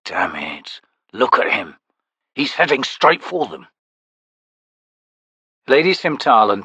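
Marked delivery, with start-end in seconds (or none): 0.0-3.7 hissed whisper. A narrator's reading. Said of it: "Rallick hissed between his teeth".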